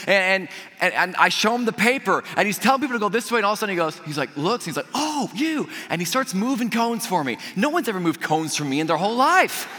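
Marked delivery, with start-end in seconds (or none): 4.9-5.7 high, drawn-out realization; retold surprised recognition. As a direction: act out suddenly recognizing a special guest. Attendant recognizes special guest.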